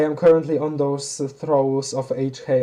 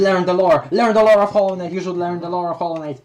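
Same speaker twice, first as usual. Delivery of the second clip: louder, higher and faster all at once; emphatic urging; 4 semitones higher, 6 dB louder than usual. Real, not acted. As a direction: bark the command at the viewer with mock zeal. Urging viewer to learn lore.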